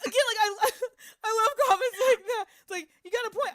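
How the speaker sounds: high laughing voice. Real, not acted. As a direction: laugh through the words. Laughing about comment.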